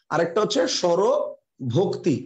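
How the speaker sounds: slow, deliberate dictation of the term; slow and clear. Shown as a demonstration. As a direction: drill the new word slowly for the class. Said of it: "এটা একটু মাথায় রাখবেন". (ধীরে স্পষ্ট করে বলা)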